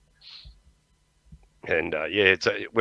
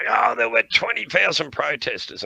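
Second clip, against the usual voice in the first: higher-pitched mock outraged YouTuber; raised-pitch mocking quote of YouTube idiots; 7 semitones higher than usual. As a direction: imitate an overexcited online idiot. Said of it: "these idiots are getting on, on YouTube going".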